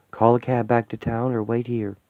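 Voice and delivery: usual speaking voice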